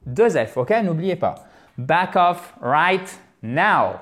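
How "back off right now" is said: slow, word-by-word warning; deliberate, emphatic command; slow stern order. Acted. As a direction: order someone away, spacing out each word.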